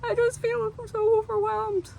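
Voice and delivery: high, slow, teary wail; imitating a sob-choked plea; hammed-up overwhelmed whimper; mock crying. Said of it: "burst out crying and be like".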